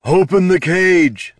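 loud, slow, high yell. A narrator's reading. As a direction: bellow it over the chaos. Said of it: "Tyron yelled".

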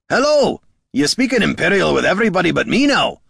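loud, high-pitched holler across a distance. A narrator's reading. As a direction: call out to someone far away. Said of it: "he hollered to the distant goblin".

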